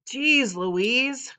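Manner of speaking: high, loud, drawn-out outburst; borrowed dad-style cry of exasperation; exasperated exclamation. A narrator's reading. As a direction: holler it like a fed-up parent. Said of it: "borrowing Dad's lines".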